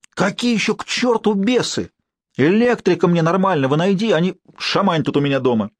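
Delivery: high, exasperated scolding; exasperated boss. A narrator's reading. Max scolding the caretaker about the demons, exasperated. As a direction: lose patience with the old man.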